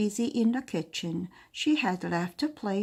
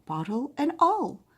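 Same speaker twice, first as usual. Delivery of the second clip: plain reading voice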